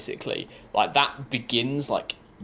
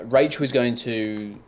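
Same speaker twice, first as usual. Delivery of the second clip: usual speaking voice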